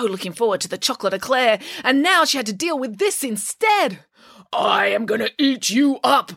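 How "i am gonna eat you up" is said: lightly voiced troll declaring. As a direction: play the troll grandly. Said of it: "declared the troll".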